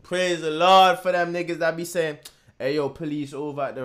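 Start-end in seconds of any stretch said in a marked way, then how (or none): none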